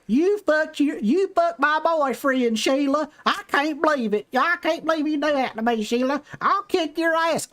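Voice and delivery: high, drawn-out Southern trash-TV wail; mock a Southern guest feuding on daytime TV; Southern talk-show guest. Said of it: "a Southern people on, like, Maury or Jerry Springer".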